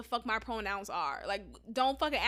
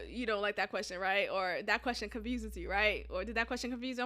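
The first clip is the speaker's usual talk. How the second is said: gentle therapist; 4 semitones lower, 6 dB quieter, faster than usual. Retold or imitated. Retold therapist's gentle follow-up.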